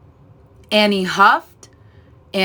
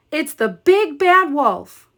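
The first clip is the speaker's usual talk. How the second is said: high-pitched, frightened; alarmed cry; 8 semitones higher than usual. A narrator's reading. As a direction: squeak out the danger. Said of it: "cried Horace".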